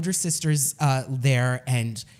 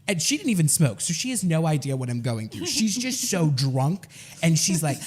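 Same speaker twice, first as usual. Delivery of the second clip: normal talking voice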